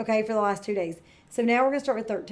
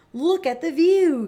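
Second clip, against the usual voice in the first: high and loud, slow amazement; gasped; 6 semitones higher, 8 dB louder than usual. A narrator's reading. Madame Gazelle shows children the valley, amazed. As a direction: gasp at the view. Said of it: "gasped Madame Gazelle".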